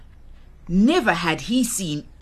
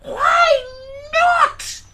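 very high, loud squealed challenge; indignant pig; 4 dB louder than usual. Acted. Pig challenging Theo's doubts, indignant shout. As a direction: shriek it back in defiance.

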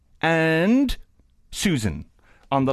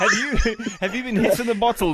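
laughing while asking, quick; teasing through laughter; 6 semitones higher than usual. Real, not acted. Host laughs at wrong answer.

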